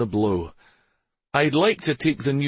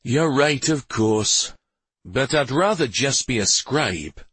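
mildly sour, higher-pitched reply; 6 semitones higher, slower than usual. A narrator's reading. Husband reluctantly agreeing with his wife, mildly sour. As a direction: concede it with a touch of sourness. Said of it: "replied in a sour tone".

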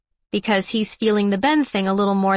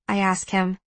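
ordinary delivery

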